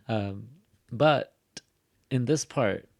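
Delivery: ordinary delivery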